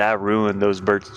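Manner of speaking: ordinary talk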